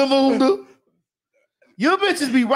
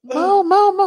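calling out; 8 semitones higher, 5 dB louder than usual. Retold or imitated. Fans calling out to Mo.

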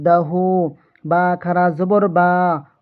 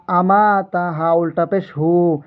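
normal talking voice

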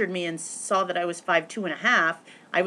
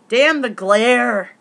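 mock exasperation; 5 semitones higher, 10 dB louder, much slower than usual. Annoyed at photo glare.

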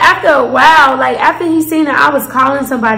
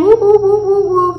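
higher, voicing a police siren; siren imitation; 6 semitones higher than usual.

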